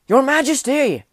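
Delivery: high, loud call; crying out. A narrator's reading. Soldier keeps calling for his king, loud high cry. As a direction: keep calling across the battle. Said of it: "cried the soldier".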